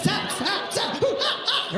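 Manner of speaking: string of high mock yelps; pained yelps. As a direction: yelp repeatedly as if stabbed. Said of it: "a knife fight on your butthole".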